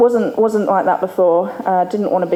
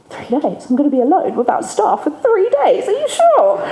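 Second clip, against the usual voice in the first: mock panicked disbelief; 7 semitones higher than usual. Left alone without staff for three days, panicking and climbing higher. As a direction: play up the shock, climbing higher to the end.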